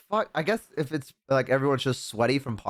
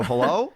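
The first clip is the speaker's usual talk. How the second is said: imitated hello with a rising, questioning end; 2 semitones lower than usual. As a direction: call "Hello?" as if checking someone is still there. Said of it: "Like that".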